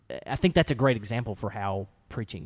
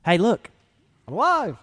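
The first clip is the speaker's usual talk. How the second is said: mock announcement; 9 semitones higher, 6 dB louder than usual. He doesn't just come out and say.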